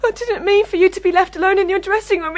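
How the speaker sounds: tearful apology, pitched well up; crying. Acted. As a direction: sob out the apology. Assistant breaks down apologising.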